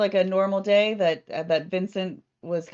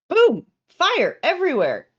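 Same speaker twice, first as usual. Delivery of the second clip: excited burst; 8 semitones higher, 7 dB louder than usual. Retold or imitated. Sudden fire in retelling.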